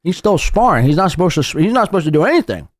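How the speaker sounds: talking while crunching ice; words round a mouthful of ice; chewing ice. Real, not acted. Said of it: "Sorry, I'm chewing ice".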